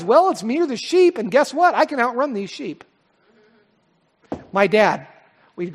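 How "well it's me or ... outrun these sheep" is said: cowardly hired man. Retold or imitated. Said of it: "guy says".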